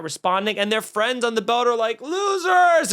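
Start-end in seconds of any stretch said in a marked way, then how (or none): 2.0-2.9 high jeering yell of "Losers!"; mocking heckle. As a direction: taunt them from the boat. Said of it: "yelling and heckling them".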